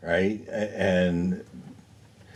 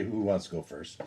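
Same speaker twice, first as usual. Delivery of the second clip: softer, understated quoted question; low-key question; 3 semitones lower, 10 dB quieter than usual. Acted. Player voices asking who goes first, soft and low-key. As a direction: ask it quietly, almost as an aside.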